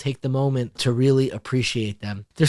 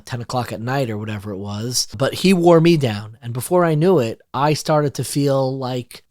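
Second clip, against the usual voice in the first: usual speaking voice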